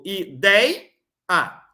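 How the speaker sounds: "i, dei, a" said as separate beats; syllable by syllable. Shown as a demonstration. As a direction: split "Ideia" into three clear syllables. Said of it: "separar sempre duas mais uma". (separação silábica, sílaba por sílaba)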